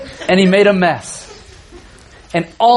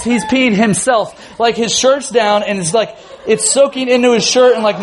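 ordinary talk

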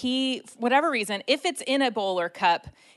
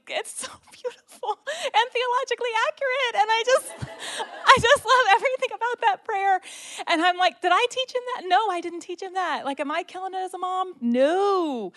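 high, teary, overwhelmed with joy; real tears of happiness breaking through; laughing through tears; 11 semitones higher than usual. Real, not acted. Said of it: "I'm, like, losing it".

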